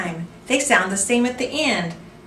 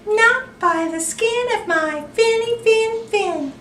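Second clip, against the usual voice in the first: high, squeaky little fish, loud; tiny fish voice; 14 semitones higher, 3 dB louder than usual. Acted. Little fish refusing the shark, high and squeaky. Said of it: "the smart little fish replied".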